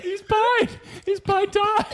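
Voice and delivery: laughing. Real, not acted. Cracking up at answer.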